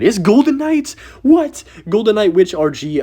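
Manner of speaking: loud, high cry of disbelief; shocked outburst. Real, not acted. Gamer shocked at the opponent's deck, yelling high.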